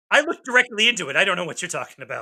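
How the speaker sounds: loud, fast joking protest; mock protest. Joking to co-host about staring at the eclipse, loud mock protest. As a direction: blurt it out loudly, quickly.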